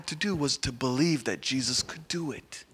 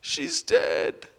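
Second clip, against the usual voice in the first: crying mother; much slower than usual. Acted. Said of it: "started crying and said".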